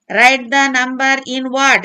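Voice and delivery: very slow, word by word; slow dictation. Shown as a demonstration. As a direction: read it out slowly for the class. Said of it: "reads instruction slowly".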